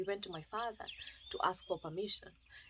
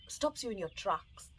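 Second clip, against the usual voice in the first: ordinary delivery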